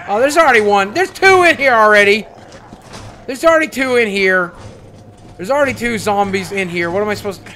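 loud, high cry of dismay; alarmed protest. Real, not acted. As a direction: exclaim it in disbelief. Finds zombies already inside.

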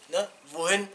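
normal talking voice

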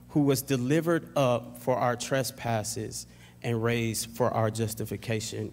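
ordinary talk, nothing marked

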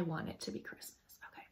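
whispered insistence, soft; playful whisper. Real, not acted. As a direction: sneak it in under your breath. Repeats the whispered Christmas confession.

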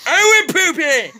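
high, loud I went poopy!; triumphant high yell; gleeful shout. Pretend. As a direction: announce I went poopy! to the whole house.

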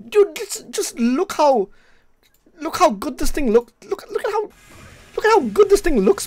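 high-pitched hyped squeal; excited. Real, not acted. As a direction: voice jumps way up, thrilled. Hyped reaction to Tekken trailer.

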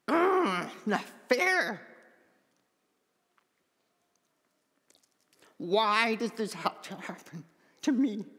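frustrated outburst. Retold or imitated. After no healing at the service, recalling his frustrated cry. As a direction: relive the clenched-fist moment, half muttering. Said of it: "resulted in a clenching of the fist".

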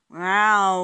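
loud, long swooping engine whine; aeroplane noise. A narrator's reading. Baby Bear pretending to be an aeroplane, loud imitated drone. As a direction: play at being a plane, drawing it out.

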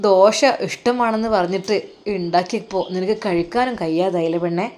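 usual speaking voice

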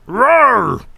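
high-pitched rising and falling roar; Gorn roar. Retold or imitated. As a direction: imitate a speechless reptile alien's roar. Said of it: "he sounds like that".

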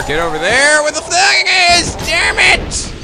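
high, loud exasperated scream; real rage at the game; furious yell. Real, not acted. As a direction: build to a screamed curse. Rage at own game character.

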